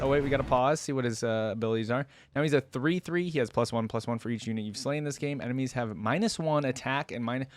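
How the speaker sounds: ordinary delivery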